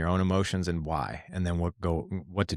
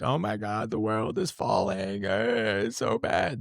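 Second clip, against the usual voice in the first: whiny negative Nelly; 2 semitones higher, slower than usual. Retold or imitated. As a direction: ham up a doom-saying complainer. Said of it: "crying and negative Nelly".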